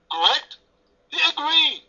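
ordinary talk, nothing marked

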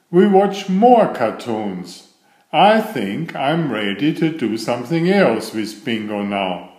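ordinary delivery

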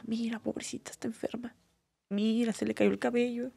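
gossiping whisper. Retold or imitated. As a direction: whisper it like neighbours pitying her behind her back. Imitating townspeople gossiping about her. (susurro de chisme compasivo)

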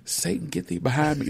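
joking high mock rebuke; hammed-up preacher rebuke, just playing. Husband joking about wife's advice, hammed-up rebuke. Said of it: "No, I'm just playing".

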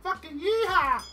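high, loud cowboy whoop; yeehaw cheer. Real, not acted. Grower celebrating his peppers and tomatoes, loud whoop.

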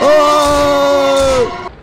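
high loud yelp; jump-scared cry; startled shout. Real, not acted.

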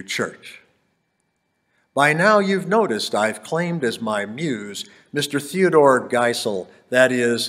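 rhythmic Dr. Seuss style reading; bouncy galloping verse. Acted. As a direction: bounce through the rhymes playfully. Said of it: "wrote in Anapestic tetrameter, and it has that rhymy sound".

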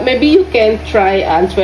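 ordinary talk

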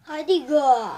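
loud cry stressing gol; will the ball into the net; excited shout. Real, not acted. Urging a goal.

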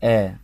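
آ as a long open "aa", said loud; open long alif. Shown as a demonstration. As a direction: model the vowel آ on its own, stretched and firm. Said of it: "يعني حرف ال A هنا بيتنطق".

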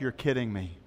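the crackers just dropped; boy learning the food was free, quietly stunned; stunned disbelief. Retold or imitated. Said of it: "he's like".

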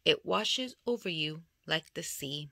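ordinary delivery

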